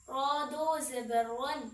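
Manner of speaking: a full, heavy "रॉ" on this drill line. Shown as a demonstration. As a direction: sound the "रॉ" full. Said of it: "रॉ को पुर ही पढ़ेंगे".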